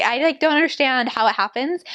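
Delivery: ordinary talk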